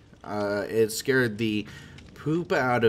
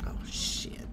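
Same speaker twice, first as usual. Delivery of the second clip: hushed, unvoiced whisper; whispered curse; 13 dB quieter than usual. Real, not acted. Player to himself as the game loads, whispered. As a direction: whisper it under your breath.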